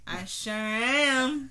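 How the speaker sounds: put-on voice. Shown as a demonstration. Repeating her line to partner in a slow silly voice. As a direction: stretch it out in a silly voice. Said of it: "yeah, I sure am".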